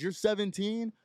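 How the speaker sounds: loud disbelief. Retold or imitated. Cop repeats the student's age aloud, astonished. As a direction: imitate a cop blurting it in astonishment. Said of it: "Like, out loud".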